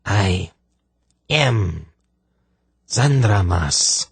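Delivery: halting rasp, long pauses between words; harsh, rasping voice. Acted. Possessing sorceress yields her name to Polgara, harsh and rasping. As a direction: force it out word by word. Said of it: "harsh, rasping voice".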